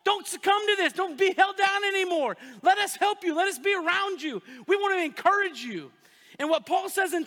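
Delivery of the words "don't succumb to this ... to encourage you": high, loud urging plea; loud pleading encouragement; rousing exhortation. Retold or imitated. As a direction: push them up out of the pit.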